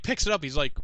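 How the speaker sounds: usual speaking voice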